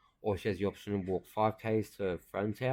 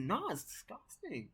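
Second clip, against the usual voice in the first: high, slow mock disgust; girl disgusted; 13 semitones higher, 5 dB quieter, slower than usual. Girl reacting to the video, high and slow. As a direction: drag it out, a bit grossed out. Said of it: "It's like".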